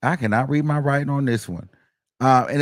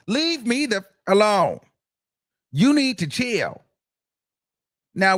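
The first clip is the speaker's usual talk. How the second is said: higher, impatient brush-off; imitating him telling her to chill; 8 semitones higher than usual. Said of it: "leaves her a voice note that says".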